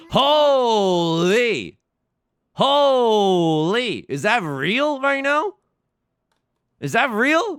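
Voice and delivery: loud high disbelieving shouts; real shocked yelling; stunned excitement. Real, not acted. Stunned by big mahjong win.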